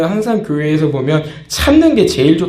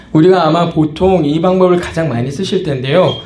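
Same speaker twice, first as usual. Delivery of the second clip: usual speaking voice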